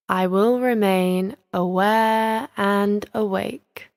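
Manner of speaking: very slow, deliberate affirmation; meditation affirmation. Shown as a demonstration. Teacher giving listeners words to say to themselves, slow affirmation. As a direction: draw out each word for them to repeat inwardly. Models a resolve to repeat inwardly.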